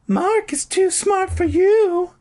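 put-on very high, slow girly call; high announcing voice. [name]'s high voice.

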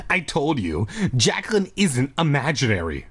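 normal talking voice

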